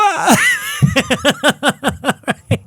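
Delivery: bursts out laughing high; high laughing shout. Real, not acted.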